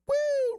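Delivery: high loud celebratory whoop. Real, not acted. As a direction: whoop like a hype man. Whoop after punchline.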